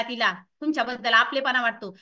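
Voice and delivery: ordinary talk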